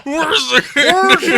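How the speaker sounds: very high, loud mock shriek; hollered catchphrase impression. Friends joining in on a quoted line, hollered impression. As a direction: screech it out as a silly impression.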